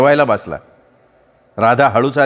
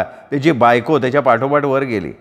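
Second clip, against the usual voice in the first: ordinary delivery, nothing marked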